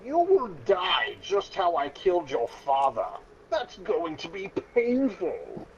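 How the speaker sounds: a lightly voiced gloating robot villain; villain threat. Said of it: "he said".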